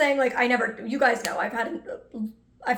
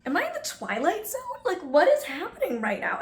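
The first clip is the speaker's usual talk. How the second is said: high-pitched, swooping incredulous questions; baffled disbelief; 6 semitones higher than usual. Retold or imitated. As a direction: play up the bewilderment, half laughing. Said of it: "I'm confused and baffled".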